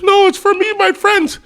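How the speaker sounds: put-on very high, loud kid refusal; exaggerated high-pitched mock kid voice; imitated kid. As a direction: squeal it like a protesting kid.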